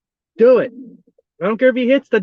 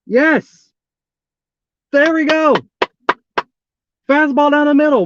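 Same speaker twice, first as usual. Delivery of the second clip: higher-pitched cheer for the pitcher; excited relief; 4 semitones higher, 3 dB louder than usual. Real, not acted. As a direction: cheer the strike with real relief. Pitcher finally throws a strike.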